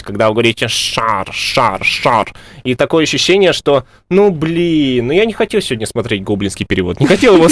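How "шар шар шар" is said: «шар» through the nose; nasal twang on «шар»; nasal lisp. Shown as a demonstration. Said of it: "слышится гнусавость".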